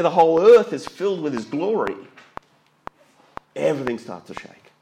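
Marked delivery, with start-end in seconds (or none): 0.0-2.0 loud declaration. Acted. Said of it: "loud declaration".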